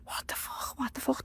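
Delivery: breathless horrified whisper; hissed in shock at the sight; panicked whisper. Retold or imitated. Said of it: "I started screaming".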